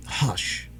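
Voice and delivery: whispered hush. A narrator's reading. John hushes his wife in a whisper. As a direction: breathe it to calm her. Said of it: "said John".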